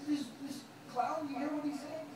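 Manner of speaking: high, soft sneering aside; a mocking king sneering to his courtier; mocking pharaoh. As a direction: scoff at him to the man beside you. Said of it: "He looks at the guy next to him".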